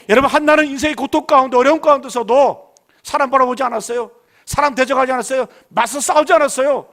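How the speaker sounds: loud rising sermon cry, leaning on each phrase; rally the flock, voice pushed out and ringing; full-force preaching. Acted. Pastor rousing the congregation about life's enemies, loud preaching.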